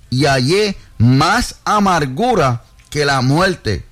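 deliberate, phrase-by-phrase Bible reading; slow scripture reading. Real, not acted. Reads verses so listeners get the root, slow reading. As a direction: read slowly, pausing to let it land. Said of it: "los voy a leer despacio".

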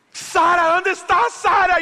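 put-on very high, loud shout for a lost child; desperate calling. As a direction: shout the name across the aisles in panic. Father searches, calling his daughter. (llamado desesperado a gritos)

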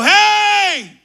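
high, loud, slow cry for help; shouting for help while holding the man down; desperate shout for help. Retold or imitated. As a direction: yell for help as he slips away. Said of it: "Y en una le digo".

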